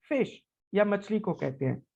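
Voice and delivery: normal talking voice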